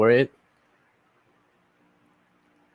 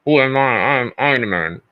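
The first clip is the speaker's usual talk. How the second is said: Iron Man in the suit; 3 semitones higher than usual. Shown as a demonstration. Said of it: "the one when he is in the Iron Man suit".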